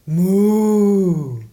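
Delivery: loud drawn-out "Muu" like a cow; a hearty German cow's moo; cow moo imitation. Shown as a demonstration. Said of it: "verbi on muuen".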